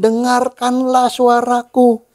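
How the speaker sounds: slow, stretched-out proclamation; high, slow, emphatic call; slow divine command. Acted. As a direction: draw out each word as God commanding. Voices God's solemn command.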